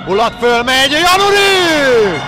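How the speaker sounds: shouting the save; loud, high, stretched-out shout; excited sports commentary. Real, not acted. Big save call.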